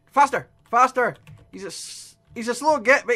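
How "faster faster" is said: louder urgent command; urgent shout. Real, not acted. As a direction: push yourself to run. Fleeing monster.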